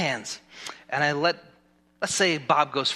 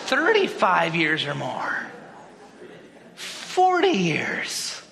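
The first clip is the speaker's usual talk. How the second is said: drawn-out call, climbing much higher; building roll call; 11 semitones higher, 4 dB louder, slower than usual. Real, not acted. Pastor polling the congregation on marriage years, rising excited call. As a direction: call out each milestone bigger than the last.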